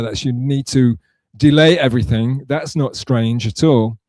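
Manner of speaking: ordinary talk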